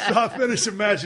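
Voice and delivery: real laughter spilling into the words; laughing. Real, not acted. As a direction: still chuckling as he goes on. Laughing after telling knockout story.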